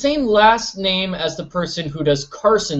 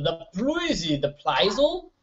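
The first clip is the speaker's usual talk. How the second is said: slow, soft, stretched the puzzle; singsong slow the puzzle; drawn-out puzzle; 2 semitones higher, 7 dB quieter, much slower than usual. Pretend. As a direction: draw out the puzzle slowly and playfully. Drawn out silly repeat.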